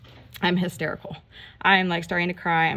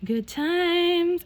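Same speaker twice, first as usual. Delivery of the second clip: much higher, drawn-out repeat; playful high-pitched exclamation; high singsong; 11 semitones higher than usual. Real, not acted. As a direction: lilt it up high, mock-cheerful.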